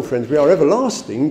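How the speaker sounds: ordinary talk, nothing marked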